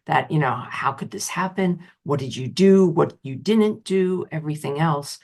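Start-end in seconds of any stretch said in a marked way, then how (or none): none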